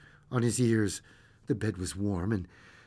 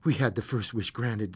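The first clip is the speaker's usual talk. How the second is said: usual speaking voice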